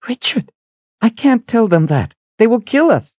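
high, urgent female character voice; anxious plea, pitched well up; alarmed woman. Acted. Kalen alarmed, refusing to translate.

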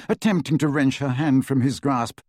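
usual speaking voice

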